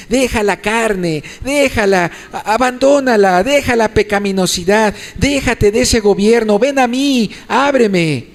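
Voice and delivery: high, urgent preaching plea; rising, insistent exhortation; urgent appeal. Acted. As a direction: implore the listener to let go and come. Preacher voices Christ's urgent appeal.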